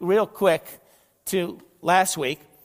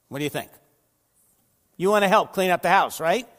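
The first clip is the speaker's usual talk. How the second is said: normal talking voice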